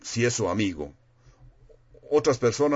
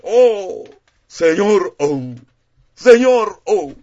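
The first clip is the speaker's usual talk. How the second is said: loud, much higher cries to God; mock emotional shouting; 9 semitones higher, 11 dB louder, much slower than usual. Preacher mocking a shouting prayer, loud high wails. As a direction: overdo the wailing prayer, far louder. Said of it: "de estar gritando a, a Dios, de sudar".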